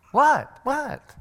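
high-pitched, startled double "what"; mock-astonished parent, voice jumping up; oblivious parent's surprise. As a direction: react as if you had no idea. Oblivious parent reacting in disbelief.

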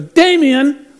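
put-on loud, high shout of a child's name; mother's yell. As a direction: imitate a mom yelling at her kid. Mother calls out to unruly kid.

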